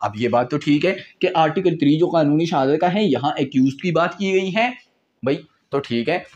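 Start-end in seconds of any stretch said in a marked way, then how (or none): none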